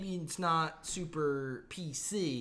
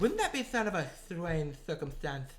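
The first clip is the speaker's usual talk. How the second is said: mock British accent, S's as "th", R's as W; lisping British accent. Repeating the example for the co-host, British lisp. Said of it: "That's a weird accent".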